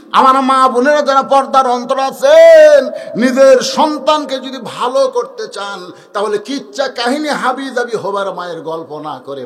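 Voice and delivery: loud, high chanted exhortation; full-force preaching. Real, not acted. Preacher urges mothers to raise children well, chanted exhortation. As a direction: exhort the crowd in preacher's singsong.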